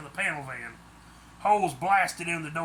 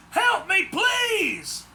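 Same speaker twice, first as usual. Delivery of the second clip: much higher, louder desperate cry; woman screaming for help; 6 dB louder, much slower than usual. A narrator's reading. A kidnapped woman cries out for rescue, high and loud. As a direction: scream it out in terror. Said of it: "a woman's voice echoed".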